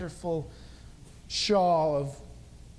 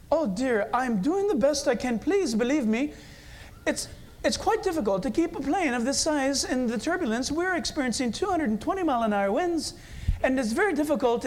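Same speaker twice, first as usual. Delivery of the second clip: higher, flustered mock British captain; apologetic British pilot; 6 semitones higher than usual. British pilot explaining turbulence to passengers, apologetic mock British accent. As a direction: plead politely in an amateur British accent. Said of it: "he's, like, apologizing".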